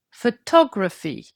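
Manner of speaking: photography with the weight moved onto its second syllable; stress on second syllable. Shown as a demonstration. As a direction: hit the TOG in photography. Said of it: "The stress shifts".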